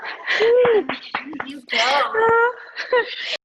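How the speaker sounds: high, loud, overcome laugh-cry; tearful laugh. Real, not acted. Winner just given the award, tearful laugh. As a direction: laugh through happy tears.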